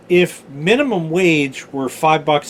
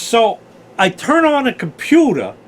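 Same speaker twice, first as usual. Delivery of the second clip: put-on New York accent; 5 semitones higher, 4 dB louder than usual. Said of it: "this has to do with a New York accent".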